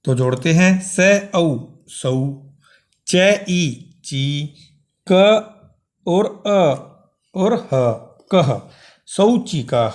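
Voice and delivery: teacher blending sounds into शौचिकः; sounding out letters. Shown as a demonstration. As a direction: spell out स औ सौ, च ई ची, then join into शौचिकः. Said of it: "ऐसे ही हमने इस पद को जोड़ना है".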